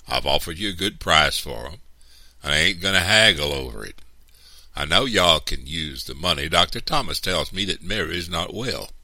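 loud, threatening pressure; forceful, insistent threat; menacing. A narrator's reading. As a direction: lean hard on them with menace. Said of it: "his voice low and menacing".

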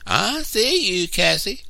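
put-on a boy spotting his sister behind a tree; triumphant shout. A narrator's reading. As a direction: shout in triumph at finding her. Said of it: "he shouted".

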